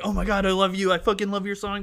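hammed-up starstruck gushing, half-shouted; mock fan scream. Fan on the phone with the rapper, mock excited scream. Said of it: "will just scream into the fucking, you know, phone".

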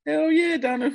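high, loud enthusiastic agreement; excited yes. Real, not acted. Host excitedly confirming the player's old name, high and loud. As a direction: burst out with it.

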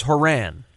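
Haran as huh-RAN; broadcasters' pronunciation. Shown as a demonstration. Co-host correcting the other on a player's name, broadcasters' pronunciation. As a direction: stress the second syllable of Haran, rhyming with ran. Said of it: "every broadcaster says".

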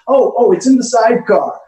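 high, loud hammed-up reply; loud high mock answer from across the room; Pops playing along. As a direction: shout back playing along with the joke. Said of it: "He goes".

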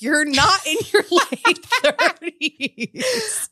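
high, slow, laughing through the words; laughing retort. Retold or imitated. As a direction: crack up while correcting her. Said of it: "And I'm like".

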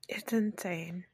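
breathy whisper of disbelief, very soft. Real, not acted. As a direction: breathe it out, barely voiced. Hushed aside in disbelief.

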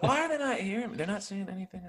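puzzled plea at half volume; wondering aloud, high and hushed; baffled retelling. Retold or imitated. Retelling own bewilderment in scene.

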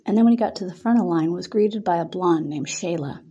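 ordinary delivery, nothing marked

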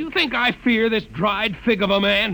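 scornful challenge; contemptuous scorn. Acted. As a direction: spit out the insult. Hugh scorns the witness.

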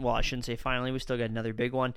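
ordinary talk, nothing marked

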